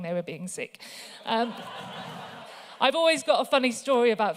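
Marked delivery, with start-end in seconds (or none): 1.6-2.8 breathy silent laugh. Real, not acted. Laughing at her own story.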